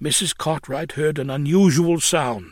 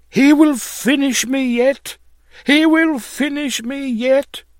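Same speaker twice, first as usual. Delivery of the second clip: high, louder cry of frantic alarm; wild excitement; 10 semitones higher, 4 dB louder than usual. A narrator's reading. Drunken lodger exclaiming to her landlady, wild with excitement. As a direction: exclaim it high and wildly, word by word. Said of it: "she was wild with excitement, exclaiming".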